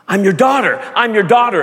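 loud, high retold cry; retold scream. Girl running home to her English-speaking mother, retold screaming. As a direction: call it out urgently, then repeat it. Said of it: "she's screaming in Korean to her mother".

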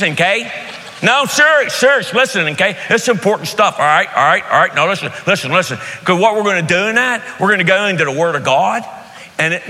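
put-on high, insistent Pittsburgh accent. Pittsburgh guest speaker urging everyone to listen, broad local accent. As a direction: play an excitable guest speaker in thick Pittsburgh talk. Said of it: "here's what it would sound like".

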